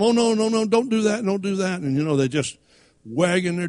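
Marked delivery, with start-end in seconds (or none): 0.0-1.8 high, sing-song puppy scolding; ineffectual pleading scolding. Acted. Owner telling a puppy off, high and ineffectual. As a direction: plead higher with a naughty dog, no authority. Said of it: "the more you tell them".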